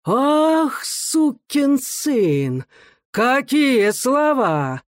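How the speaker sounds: high, drawn-out astonished exclamation; incredulous, stretched high wail; amazed sing-song. A narrator's reading. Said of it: "свистящим голосом изумлённо запел".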